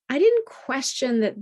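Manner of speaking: ordinary delivery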